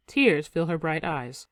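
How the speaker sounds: usual speaking voice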